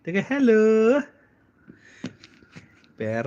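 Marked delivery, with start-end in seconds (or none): 0.2-1.0 high, stretched-out greeting; baby-talk prompting. Shown as a demonstration. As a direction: draw it out sweetly for a child to copy. Models hello for toddler to copy. (ਬੱਚੇ ਲਈ ਉੱਚੀ ਲਮਕਵੀਂ ਆਵਾਜ਼)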